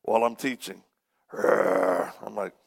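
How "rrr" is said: low imitated growl; mock demonic growl. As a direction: growl from deep in the throat. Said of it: "starts growling".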